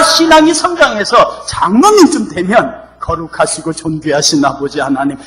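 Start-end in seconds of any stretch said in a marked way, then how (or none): 3.0-5.2 softer, lower mock-solemn prayer; solemn elder's prayer. Elder praying to God, mock solemn. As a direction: lightly imitate a pompous elder praying. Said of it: "이렇게 기도하는 겁니다".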